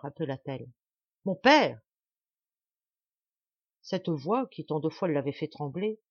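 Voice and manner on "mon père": loud, commanding repeated call; imperious summons, louder and insistent. A narrator's reading. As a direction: demand his attention sharply. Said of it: "de son accent le plus impérieux".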